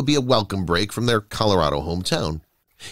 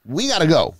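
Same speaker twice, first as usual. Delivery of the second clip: urgent, higher-pitched, not truly screamed; a touch of panic, voice raised in pitch; mild narrated scream; 6 semitones higher than usual. A narrator's reading. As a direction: push to get away, with understated panic. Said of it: "he screamed".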